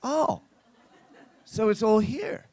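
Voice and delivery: high, amazed, with a laugh; wide-eyed visitor marvelling; impressed surprise. Retold or imitated. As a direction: gasp it out, delighted. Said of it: "he's like".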